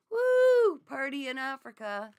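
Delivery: high, loud woo, then a cheer; excited whoop. Real, not acted. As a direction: whoop for joy at the news. Cheering a viewer's trip.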